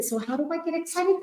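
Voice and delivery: quick, high excited question; bubbling over as she asks it. Real, not acted. Host asks her viewers, quick and high with excitement. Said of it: "although I am excited".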